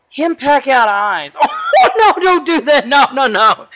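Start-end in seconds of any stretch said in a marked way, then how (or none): 2.0-3.7 high, loud laughing protest; laughing alarm. Real, not acted. Dad stopping child's eye-pecking game, laughing alarm. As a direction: protest through a burst of laughter.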